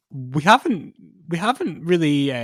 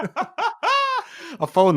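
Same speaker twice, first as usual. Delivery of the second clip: laughing; much slower than usual. Real, not acted. Laughing about the silly song.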